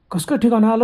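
high, loud puzzled cry; startled question. Acted. Driver shouting back at passenger, startled and loud. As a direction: blurt out the disbelief. Driver baffled at stop demand.

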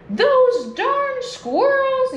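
angry yell. A narrator's reading. Old man furious at the squirrels, slow high yell. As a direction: drag out the curse in a strained old man's voice. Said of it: "yelled Old Man Feuquier".

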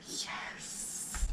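barely audible whispered yes; hushed, voiceless repeat. Real, not acted. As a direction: whisper it like a faint echo.

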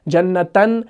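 جنتن ending in "-tan", rising. Shown as a demonstration. Teacher reciting the singular's second case, -an ending on جنتن. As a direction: say جنتن as jannatan, short a before the n. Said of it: "یہ واحد ہے".

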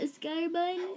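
rising, doubtful question; unsure question. Shown as a demonstration. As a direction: ask it like you're not sure of the name. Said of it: "Is that what you call it?".

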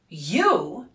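loud, outraged shout; father shouting in outrage at the suitor; enraged shout. A narrator's reading. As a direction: shout the word in disbelief. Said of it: "shouted the enraged father".

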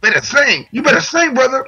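imitating a high-pitched holler; mild mock yell. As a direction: playfully mimic someone yelling praise from a car. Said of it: "in the car screaming at me".

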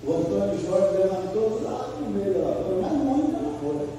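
usual speaking voice

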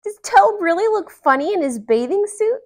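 high, loud little lizard voices; squeaky curious lizards piping up; lizards asking. A narrator's reading. Lizards asking Frog about Toad, high and eager. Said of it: "they asked".

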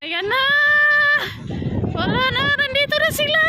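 thrilled yelling; excited rising shout. Real, not acted. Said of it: "tuwa ako".

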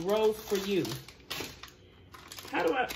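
ordinary delivery

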